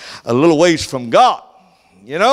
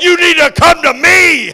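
high, loud, booming call; God's call; 12 semitones higher, 7 dB louder than usual. Retold or imitated. God calling the backslider back, high and loud. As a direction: call the wanderer home with authority. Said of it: "he said".

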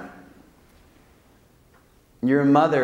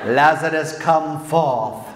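hammed-up British film accent, a bit louder; mock British movie accent; 3 semitones higher, 4 dB louder, much slower than usual. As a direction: boom it out like Jesus in an old British movie. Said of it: "he says in a British accent".

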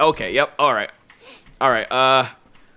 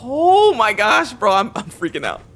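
higher, louder fake freak-out, slowed on the first cry; mock panic; 5 semitones higher, 5 dB louder than usual. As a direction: ham up being scared. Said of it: "Just kidding".

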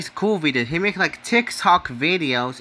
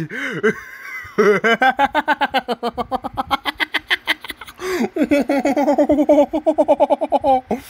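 long helpless high giggling; giggle fit; 9 semitones higher than usual. Real, not acted. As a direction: laugh till you run out of breath. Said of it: "Sorry for laughing".

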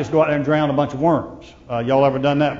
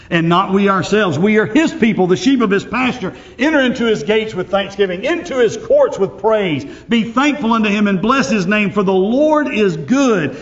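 raised, ringing pulpit voice, higher and louder; preaching at full force; 5 semitones higher, 3 dB louder than usual. Acted. Pastor proclaiming a psalm of praise to the congregation, fervent preaching.

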